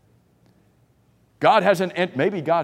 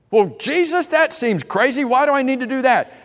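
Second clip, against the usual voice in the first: much higher, louder, mock disbelief; incredulous objector; 10 semitones higher, 4 dB louder than usual. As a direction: play a listener who thinks Jesus is crazy. Imagined listener objects to Jesus.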